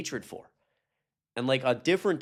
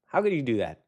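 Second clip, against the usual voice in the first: usual speaking voice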